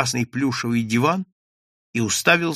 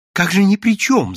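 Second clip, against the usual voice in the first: fawning; 8 semitones higher, 6 dB louder than usual. A narrator's reading. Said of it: "залебезил Каин Александрович".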